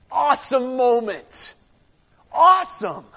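loud, high burst of awe; excited exclamation. Real, not acted. Preacher thrilled by the verse, loud and high. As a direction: burst out, punching "awesome".

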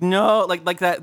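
ordinary delivery

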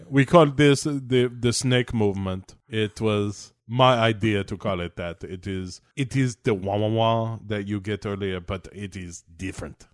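mock French-accented explanation; hammed-up Frenchman. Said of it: "suddenly he's French".

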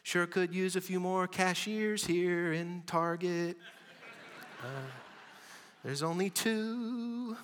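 high, slow mock whine about cashiers; hammed-up griping shopper at Target; whiny complainer. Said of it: "songs that we might sing".

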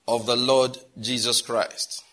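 solemn recital. Real, not acted.

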